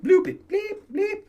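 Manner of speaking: squeaky mock censor bleep; silly bleep noises. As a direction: chirp the censor bleeps like a toy. Joking about censoring his swear.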